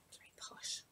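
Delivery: whispered aside. Acted. Narrator aside.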